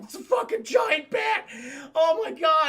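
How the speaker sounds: high, loud, hammed-up alarm; mock panicked shout. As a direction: burst in yelling the warning. Acted henchman bursting in, alarmed.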